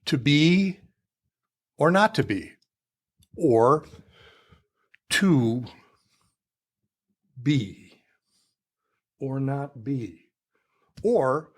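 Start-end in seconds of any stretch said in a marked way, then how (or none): 0.1-2.5 "to be", held pause, "or not to be"; pause after BE. Shown as a demonstration. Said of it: "I'll give the example of". 5.1-10.2 "to", long pause, "be", long pause, "or not be"; slow, broken line with silences after "to" and "be". Shown as a demonstration. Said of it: "Where is the pause?".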